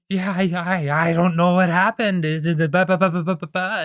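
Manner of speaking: loud, high, stammering mock panic; Mister Krabs. Panicking crab talking to a news reporter, frantic stammer. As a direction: babble in hammed-up panic. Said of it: "he's, like, panicking".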